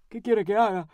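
lightly voiced helpless man, a change of voice; helpless lodger. A narrator's reading. Lodger answering his landlady, helplessly. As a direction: answer as a defeated lodger, mildly.

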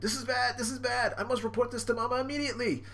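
high, hurried minion voice; hammed-up cartoon alarm; panicking henchman. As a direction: fret and rush off to report.

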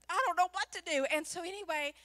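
normal talking voice

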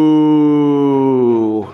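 long, loud imitated moo; drawn-out "moo", sliding gently down; cow moo. Shown as a demonstration. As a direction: moo like a cow for a small child. Imitating a toy cow for child.

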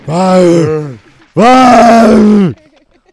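booming caveman, building to a shout; dim slow booming giant. Pretend. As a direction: bellow it like a caveman. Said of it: "Do the caveman voice".

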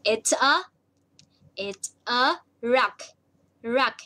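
drawn-out, careful reveal; slow teacher reveal; slow model answer. Shown as a demonstration. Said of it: "Hmm, what is it?".

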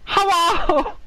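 put-on Chinese accent for a pretend worker; fake Chinese accent. Host's pretend Chinese farmer answers in a fake Chinese accent. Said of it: "I can't do the accent".

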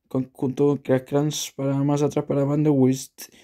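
ordinary delivery

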